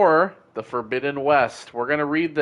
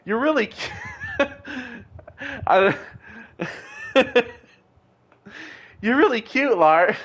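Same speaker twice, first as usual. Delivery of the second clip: giggling through a higher, flirty compliment; giggly flirt; 8 semitones higher than usual. Acted. Serafina flirting with Lars, giggling and higher. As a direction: laugh it out, charmed and shy.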